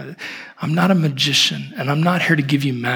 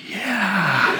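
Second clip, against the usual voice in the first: put-on high, drawn-out child's "Yeah"; lightly acted childlike wonder, pitched up; believing little girl; 6 semitones higher than usual. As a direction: mimic a small girl's wide-eyed yes. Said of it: "And she goes".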